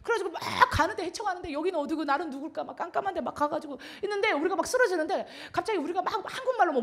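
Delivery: ordinary talk, nothing marked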